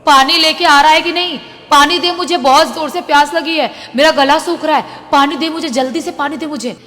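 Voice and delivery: acted furious, thirsty shouting; demanding shout. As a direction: yell desperately for water through a closed door. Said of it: "कोई चिल्लाते हुए बोल रहा है".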